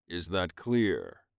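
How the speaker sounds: low, slow, stern check; slow firm demand. Acted. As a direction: make sure he understands. Rojan demanding.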